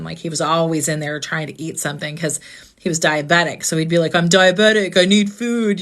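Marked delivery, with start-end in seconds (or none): 4.1-5.8 louder, dopey jock accent imitating her brother; dumb jock voice. Sister mimicking her late brother at the holidays, dumb jock voice. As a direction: play the big dumb football brother begging for food. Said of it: "he'd talk with a dumb accent and stuff".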